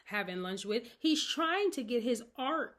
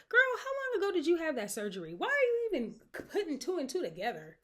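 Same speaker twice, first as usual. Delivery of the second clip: fast, high, loud telling-off; incredulous scolding; 8 semitones higher, 3 dB louder than usual. Acted. Host scolding Maya over two and two, incredulous. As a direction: scold her in high-pitched disbelief.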